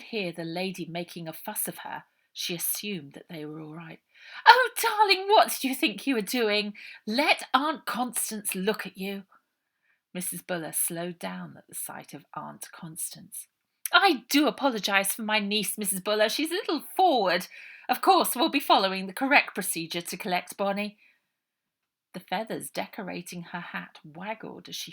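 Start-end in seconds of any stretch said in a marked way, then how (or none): 4.5-9.2 high, loud posh lady's fuss; kind and posh. A narrator's reading. Posh lady fussing over the trapped girl, high and kind. 13.9-21.0 louder, genteel apologetic poise; posh lady. A narrator's reading. Posh aunt apologising to the matron, genteel and louder. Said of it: "She sounded like a lady".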